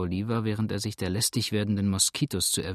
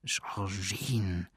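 soft, hushed call of her name; tender whisper; 2 semitones lower, 6 dB quieter than usual. A narrator's reading. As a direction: murmur the name softly, coaxing. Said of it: "flüsterte der starke Mann".

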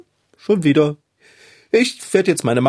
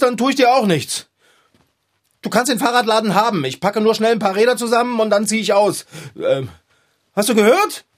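scared bluster; 8 semitones higher than usual. Acted. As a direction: talk tough while afraid. Threatening unseen creature while scared.

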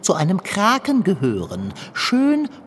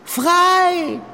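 high, louder cheer building; joyful cry; 9 semitones higher, 4 dB louder, much slower than usual. Acted. Long John cheers as the octopus slips out the porthole, joyful cry. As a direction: cheer the octopus off, rising to a shout.

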